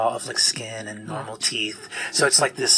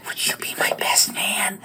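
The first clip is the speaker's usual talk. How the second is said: fairy whisper; 8 semitones higher than usual. Acted. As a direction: ask it sweetly in a whisper. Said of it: "she looks over at Skinner and says".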